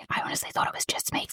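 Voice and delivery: hurried, breathless whisper; hushed rapid whisper. Shown as a demonstration. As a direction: whisper the repeated phrase fast. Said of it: "the more I say".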